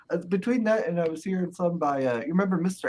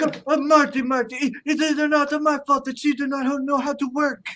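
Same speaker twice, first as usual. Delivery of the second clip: Iron Sheik impression; 9 semitones higher, 4 dB louder than usual. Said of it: "he's like".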